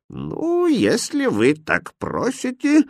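high, slow, affected consent; pompous fool, drawing it out; self-important. A narrator's reading. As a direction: pretend to grant a great favour. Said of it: "важно произнёс Рахмет".

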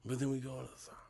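whispered aside; whispering. Real, not acted. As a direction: whisper it secretly.

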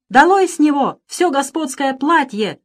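high, loud, slow order; drawn-out enraged shout; furious command. A narrator's reading. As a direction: thunder the order at the servants. Master orders servant stripped.

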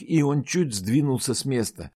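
usual speaking voice

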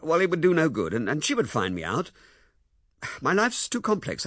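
blunt. Acted. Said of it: "he said almost brutally".

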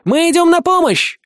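high, loud rallying shout; calling out. A narrator's reading. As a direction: shout to reach the river. Friends call from shore.

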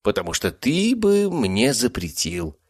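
a touch of a purr, slow; lightly voiced cat, coaxing. A narrator's reading. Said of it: "мурлыкнул кот". (мурлыча, по-кошачьи)

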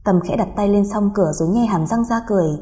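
ordinary delivery

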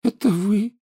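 put-on high, sulky ghost-girl voice; a gloomy schoolgirl ghost; sullen ghost girl. A narrator's reading. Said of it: "хмуро произнёс призрак".

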